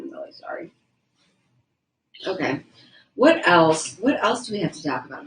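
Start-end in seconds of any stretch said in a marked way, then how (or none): 0.0-0.7 whispered apology; whispering. Real, not acted. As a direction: breathe the apology out. Whispered apology while eating.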